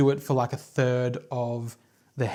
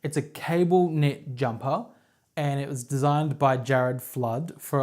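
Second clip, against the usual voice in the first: usual speaking voice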